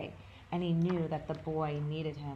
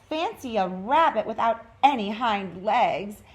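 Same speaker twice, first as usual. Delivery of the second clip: mocking wild rabbit; 4 semitones higher, 9 dB louder than usual. A narrator's reading. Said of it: "he began to laugh".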